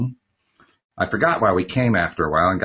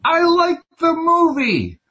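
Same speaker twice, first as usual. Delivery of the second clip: high, slow, pressed whine of irritation; irked insistence; 6 dB louder, slower than usual. A narrator's reading. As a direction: plead to be left alone with the film. Irked Richard snaps at Don in theater.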